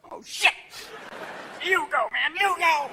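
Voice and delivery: high-pitched panicking dog; hammed-up dog freak-out; sniffer dog. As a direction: urge your partner on in alarm. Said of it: "dogs looking for anthrax are going".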